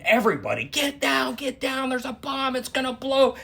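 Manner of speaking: high urgent warning call, a retold yell; high-pitched retold shout of bomb warning. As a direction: warn the crowd about the bomb, pitched high.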